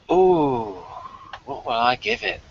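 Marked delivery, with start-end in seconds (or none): none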